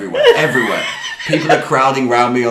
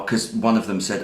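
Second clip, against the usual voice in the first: usual speaking voice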